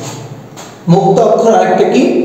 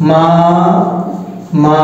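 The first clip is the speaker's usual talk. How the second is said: মা and মা said separately with a pause; syllable by syllable; 3 semitones lower, slower than usual. Shown as a demonstration. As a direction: split মামা into two syllables. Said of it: "মামাতে কয়টি অক্ষর আছে".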